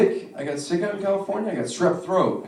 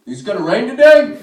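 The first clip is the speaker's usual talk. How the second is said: dumb question; 5 semitones higher, 10 dB louder than usual. Retold or imitated. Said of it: "he goes".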